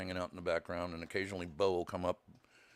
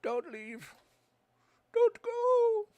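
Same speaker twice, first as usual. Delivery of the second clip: pleading; 7 dB louder, much slower than usual. Pretend. Pleading voice to viewer.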